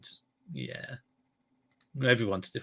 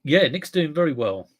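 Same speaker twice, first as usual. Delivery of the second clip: ordinary talk, nothing marked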